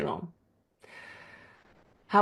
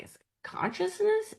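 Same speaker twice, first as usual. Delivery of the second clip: awed question; 6 dB quieter than usual. Retold or imitated. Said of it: "And I'm like".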